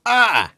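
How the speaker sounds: sharp, high yell of pain. Acted. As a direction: yelp out loud in pain. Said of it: "your father yells".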